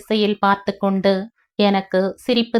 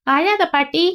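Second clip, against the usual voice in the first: put-on higher little boy comforting; a small boy soothing grandma; child's voice; 8 semitones higher than usual. Boy comforting crying grandma, child's voice. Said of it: "என்றான் குமார்".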